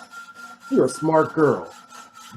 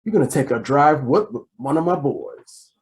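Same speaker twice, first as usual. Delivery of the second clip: ordinary talk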